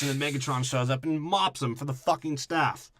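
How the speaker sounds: normal talking voice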